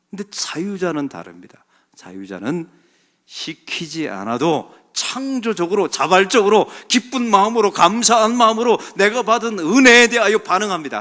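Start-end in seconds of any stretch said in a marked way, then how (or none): 3.3-11.0 loud, forceful sermon delivery; full-force preaching. Real, not acted. Preacher telling what free people do, full-force preaching. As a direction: drive each phrase home to the congregation.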